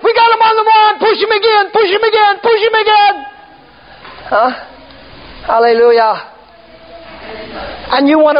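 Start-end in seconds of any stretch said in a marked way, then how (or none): 0.0-3.1 excited preaching shout. Real, not acted. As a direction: whip the crowd up to push. Preacher urging push against devil.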